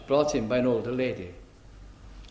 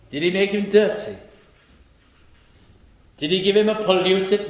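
louder, higher preaching, building each question; preaching rhetorical questions; 6 semitones higher, 4 dB louder than usual. Acted. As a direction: hammer each question at the congregation. Rhetorical questions at full force.

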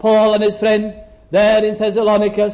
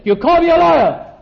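higher, louder offended comeback; indignant retort; 8 semitones higher, 3 dB louder than usual. Retold or imitated. The accused answering the whisperer, indignantly. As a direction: throw the insult back, stung and raised.

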